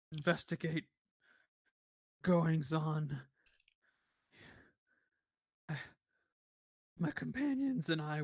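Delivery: weary, raspy survivor with long pauses; halting weak voice, a few words at a time. Acted. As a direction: struggle through the tale in a thin rasp. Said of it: "in a weak and raspy voice".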